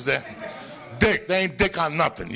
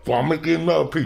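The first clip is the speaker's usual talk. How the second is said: ordinary talk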